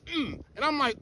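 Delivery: usual speaking voice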